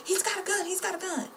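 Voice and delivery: high mock alarm cry; imitating panicked cop. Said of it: "you hear".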